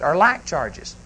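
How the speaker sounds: normal talking voice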